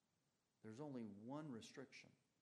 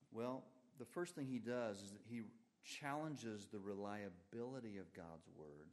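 ordinary talk

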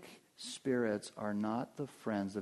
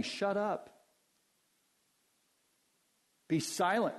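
sharp, higher shut-up; blunt rebuke; 5 semitones higher than usual. Acted. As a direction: snap it shut with authority. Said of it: "a very strong rendering of those words".

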